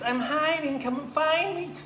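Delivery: very high, squeaky singsong; teasing tiny mouse in hide-and-seek; little girl mouse. Acted. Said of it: "She goes".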